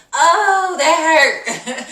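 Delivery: loud, high yelp of hurt; a loud pained squeal, half laughing; stung cry. Retold or imitated. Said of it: "in the car listening to her like".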